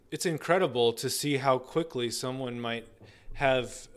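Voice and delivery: usual speaking voice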